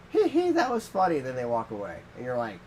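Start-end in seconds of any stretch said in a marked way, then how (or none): 0.1-1.2 put-on high, loud tee-hee kid voice; giggling toddler. Friend imitating a toddler who walked away unharmed, giggly. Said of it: "then they go".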